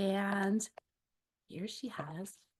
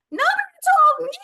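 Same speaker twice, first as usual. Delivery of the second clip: very high, loud outraged squeal; shocked squeal; 13 dB louder than usual. Acted. Duckling to crocodile, shocked to learn she is a ducky. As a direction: squeal it in disbelief.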